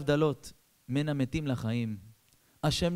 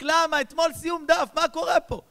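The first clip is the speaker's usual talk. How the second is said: much higher, louder anguished yell; shouting child; 9 dB louder than usual. Retold or imitated. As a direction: scream the boy's despair. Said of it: "הילד צועק". (הילד צועק בייאוש, גבוה וחזק)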